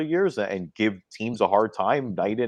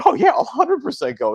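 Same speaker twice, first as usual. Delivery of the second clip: much higher, mock-eager yes; imitating eager yes; 6 dB louder than usual. Retelling how he jumped at the race, mock eager. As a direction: ham up the instant yes.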